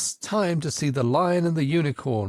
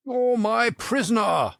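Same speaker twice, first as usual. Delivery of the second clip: much higher triumphant cry; the Red Knight crying out; 9 semitones higher than usual. A narrator's reading. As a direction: proclaim the capture, pitched high. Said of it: "the knight cried".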